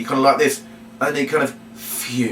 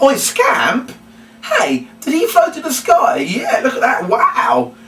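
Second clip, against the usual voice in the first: hammed-up amazement; 11 semitones higher, slower than usual. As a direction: play up your delight for the children. Showing amazement at the floating puppet.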